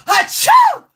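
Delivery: loud high mock sneeze; a hammed-up sneeze right at the boots; fake sneeze. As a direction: blast out an exaggerated sneeze. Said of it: "walk right up to my snow boot and go".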